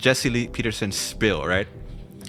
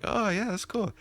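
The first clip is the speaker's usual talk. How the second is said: mock casual reply; 11 semitones higher, 4 dB quieter than usual. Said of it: "I was like".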